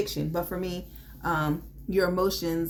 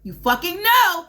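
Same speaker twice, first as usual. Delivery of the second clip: louder, higher burst of a yell; 5 semitones higher, 10 dB louder than usual. Real, not acted. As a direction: blurt it out loud at the viewers. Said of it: "I said I wasn't gonna yell the word".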